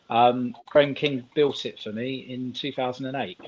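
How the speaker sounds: normal talking voice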